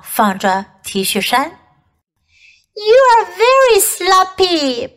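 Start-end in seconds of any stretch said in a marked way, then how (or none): 2.7-4.9 high, bright cry of a scolding puppy. A narrator's reading. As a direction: call it out high like a little dog scolding. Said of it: "Puppy cried".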